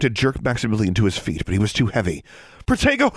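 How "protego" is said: high, sharp cry of an incantation; urgent spell-casting shout. A narrator's reading. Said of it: "she shouted".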